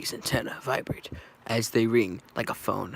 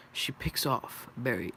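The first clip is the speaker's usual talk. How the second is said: usual speaking voice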